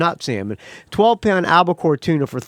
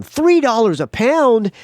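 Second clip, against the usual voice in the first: surprised disbelief; 8 semitones higher, 4 dB louder, slower than usual. Retold or imitated. Hearing the surprisingly low price.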